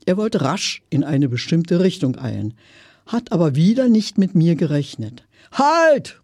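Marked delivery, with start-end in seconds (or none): 5.5-6.2 sudden high-pitched call to stop. A narrator's reading. Customer stopping the hurrying salesman, calling out. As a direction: call it out high, cutting him off. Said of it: "rief ich".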